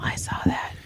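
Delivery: soft whispered tease; teasing whisper. Retold or imitated. Owner whispering to her embarrassed dog, teasing. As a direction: whisper it back, gently gloating.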